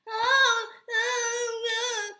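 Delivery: high, loud mock crying oh oh oh; mocking fake whimper. Mocking mother's whiny crying.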